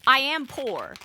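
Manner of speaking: ordinary talk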